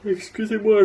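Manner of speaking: apology stretched by a real yawn; mid-yawn excuse, high and loud. Real, not acted. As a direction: say it through a big yawn. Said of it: "je baille encore".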